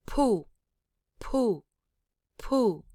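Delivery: each POO starts high and drops; aspirated POO sliding sharply down; falling tone. Shown as a demonstration. Teacher modelling the word, falling tone on each POO. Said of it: "your falling tone".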